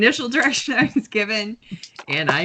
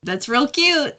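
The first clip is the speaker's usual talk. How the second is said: higher-pitched taunting brush-off; mocking dismissal; 6 semitones higher, slower than usual. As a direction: taunt the caller, unimpressed. Character mocks the luring voice.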